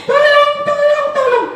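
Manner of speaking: very high, sing-song cry for help; calling for help. Acted. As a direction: call out desperately. Child calls out for help.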